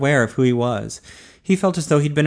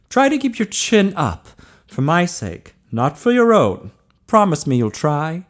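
higher-pitched doctor urging him on; kindly doctor, encouraging; 5 semitones higher than usual. A narrator's reading. Doctor encourages the young man.

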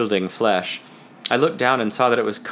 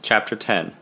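plain reading voice

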